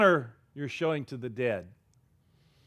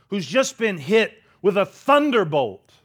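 ordinary talk

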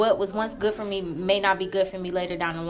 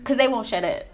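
annoyed; 4 semitones higher, 5 dB louder than usual. Real, not acted. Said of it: "They're really pissing me off".

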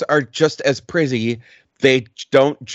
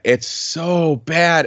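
slow, higher, stretched-out "It's so bad"; drawn-out groan; 5 semitones higher, much slower than usual. Real, not acted. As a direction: drag out "so bad" in dismay. Repeating own verdict.

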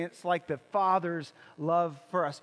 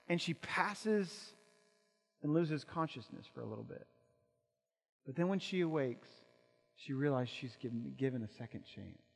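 hushed storytelling; 5 semitones lower, 7 dB quieter than usual. Acted. Hushed retelling after climax.

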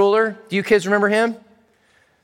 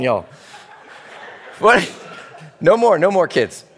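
ordinary talk

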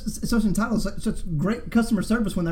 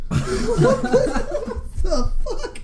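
shocked; much slower than usual. Retold or imitated. Boss reacting in retold movie scene.

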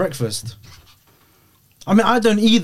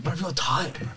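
softer mimicry of a friend's voice; imitating his "tired" voice; 11 dB quieter than usual. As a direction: lightly put on his tired-sounding voice. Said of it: "when he says it with the voice".

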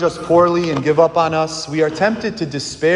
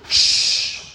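long unvoiced "shh"; shushing. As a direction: shush someone, drawn out. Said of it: "what did the people do?".

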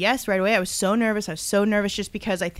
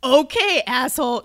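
sarcastic doctor; 4 semitones higher, 6 dB louder than usual. Retold or imitated. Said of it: "She didn't say asshole".

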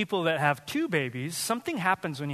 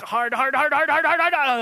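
each hard rising and louder; building strain; 8 semitones higher, 7 dB louder than usual. Retold or imitated. Tired parent escalating the hardships, building louder. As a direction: pile it on harder each time.

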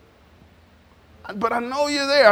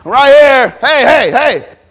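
loud, insistent calling; God calling out; 2 semitones higher, 9 dB louder than usual. Acted. God calling to people who ignore him, loud. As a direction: call out to be noticed. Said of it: "And God said".

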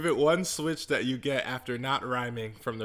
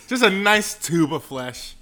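slow deadpan; 7 dB louder, slower than usual. Acted. Comic slow delivery.

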